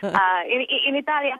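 English with a lilting Italian accent; playful demo of an Italian accent. Shown as a demonstration. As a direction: speak like an Italian speaking English. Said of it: "a bit of an Italian accent right now".

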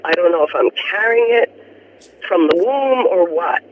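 usual speaking voice

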